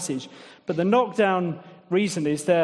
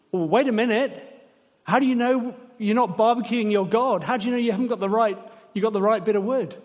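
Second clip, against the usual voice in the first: higher, fast, mocking incredulity; sarcastic mock question; 5 semitones higher, faster than usual. Preacher voicing God mocking idol makers, sarcastic and higher. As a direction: needle the idol-maker with fake puzzlement. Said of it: "It's sarcasm".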